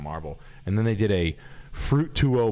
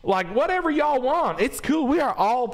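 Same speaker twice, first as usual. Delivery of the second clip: high, loud, sugary neighbour voice; mock a prim Southern lady asking nicely; Paula Deen type lady; 6 dB louder than usual. Mocking decor, a high hammed-up Southern lady voice.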